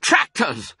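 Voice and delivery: high-pitched shout of warning; urgent, high cry; alarmed shout. A narrator's reading. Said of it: "shouted Mister Fox".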